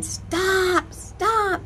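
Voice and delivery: high, urgent yell after a runaway dog; frantic call to a dog running off; yelling stop. Retold or imitated. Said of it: "and I'm yelling".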